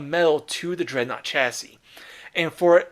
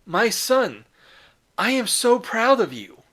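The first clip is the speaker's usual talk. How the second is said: higher, slow, tender praise of a son; proud father; 7 semitones higher, 3 dB louder, slower than usual. Retold or imitated. Guilliman praising the wounded Marine, higher and tender. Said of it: "Guilliman approaches him and goes".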